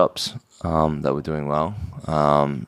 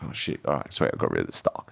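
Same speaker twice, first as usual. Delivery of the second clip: relieved whisper; 2 semitones lower than usual. Retold or imitated. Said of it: "I was like".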